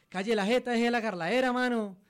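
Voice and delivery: high, loud Santander slang; Santander accent. Pretend. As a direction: shout it like a rough Santander local. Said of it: "Se dice".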